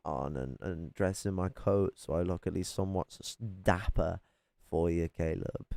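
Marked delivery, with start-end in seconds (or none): none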